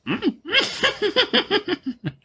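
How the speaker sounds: high burst of laughter. Real, not acted. Laughing at Yoda mixup.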